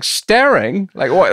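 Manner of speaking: high, loud mock disbelief; incredulous staring. Guest mocking a tube poster, high loud incredulity.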